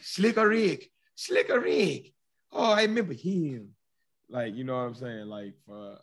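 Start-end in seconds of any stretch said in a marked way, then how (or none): 0.0-3.7 put-on high, loud old grandmother recalling; mock old granny, pitched way up; grandma voice. Imitating someone's grandmother remembering a rapper, high and loud. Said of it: "I don't know what accent that was".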